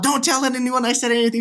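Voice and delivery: high-pitched imitation of pleading; retold panicked plea. Friend who leaked the surprise, mock panicked plea.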